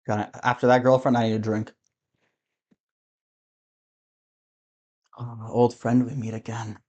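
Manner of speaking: low, soft boozy mumble to himself; a sad drunk talking into his glass; drunk muttering. Acted. Drunk man muttering again.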